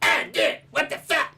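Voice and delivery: high, loud cartoon brat impression; Eric Cartman. Comparing her voice to a cartoon, Cartman impression. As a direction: squawk it like a cartoon kid. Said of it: "Early seasons, Eric Cartman".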